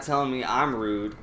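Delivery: ordinary talk, nothing marked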